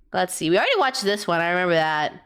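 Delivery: ordinary delivery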